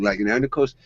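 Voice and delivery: ordinary talk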